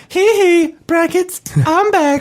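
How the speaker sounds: squeaky, very high falsetto. Acted. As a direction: yelp it in pop-star falsetto.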